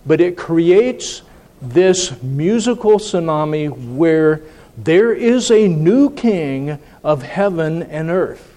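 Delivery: normal talking voice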